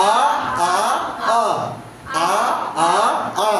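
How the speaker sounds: each "আ" fully voiced, open-throated; teacher modelling "আ, আ, আ" with gaps; central vowel drill. Shown as a demonstration. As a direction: open the throat on every separate "আ". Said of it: "এটা বেশি করে উচ্চারণ করো".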